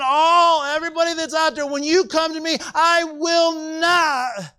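high-pitched, sustained preacher's cry; impassioned preaching. Real, not acted. Preacher telling the congregation who Jesus receives, impassioned preaching. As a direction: drive each phrase up high, stretching the promise at the end.

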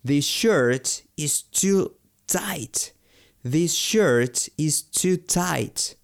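drawn-out, careful model sentence; very slow teaching demo. Shown as a demonstration. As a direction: stretch every word for learners. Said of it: "teacher models English phrases".

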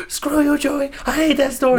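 high, snippy put-on voice of an annoyed sister; mock sister voice. Host voicing his friend's sister telling Joey off, in a high put-on voice. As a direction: squeak it out like a peeved little sister.

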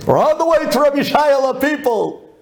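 high-pitched triumphant call; exuberant announcement. Retold or imitated. The group on the way to Rabbi Shayla, exuberant. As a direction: proclaim it to everyone in a high voice.

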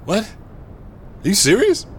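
can't believe his luck; stunned that the stranger is FBI; amazed disbelief. Acted.

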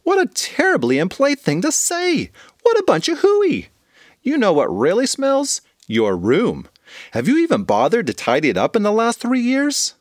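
high outraged mom scolding; scolding mother. Acted. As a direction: scold the child sharply. Mother voiced by narrator.

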